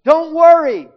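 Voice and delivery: loud reassurance. Retold or imitated. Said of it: "what he says to her".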